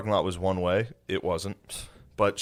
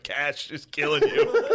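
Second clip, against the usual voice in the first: laughing friends; 6 semitones higher, slower than usual. Retold or imitated. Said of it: "all my friends were like".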